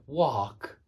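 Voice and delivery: "wok" with a long open ah, like "wahk"; American vowel. Shown as a demonstration. Teacher imitating how Americans say it, "wok" as long wahk. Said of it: "They say wok".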